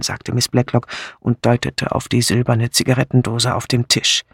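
ordinary delivery

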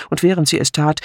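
plain reading voice

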